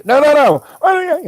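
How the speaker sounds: high, loud, rapid-fire protest; imitated tizzy. Wife wants to fight with husband, high flustered protest. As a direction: mimic a partner worked up and objecting. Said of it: "get in a tizzy about something".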